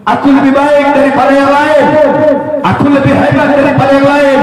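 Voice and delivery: high, loud boastful voice; mock the proud man's bragging; preacher mimicking a conceited scholar; arrogant boaster. Voicing an arrogant person.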